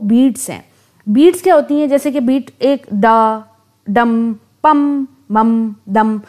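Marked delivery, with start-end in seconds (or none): 1.9-6.2 "डा, डम, पम, मम, दम" as slow, separate, even beats; single beats. Shown as a demonstration. Teacher sounding out single beats for students, slowly. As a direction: tap out each beat on its own. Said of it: "अब ये मैं beats बोल रही हूँ".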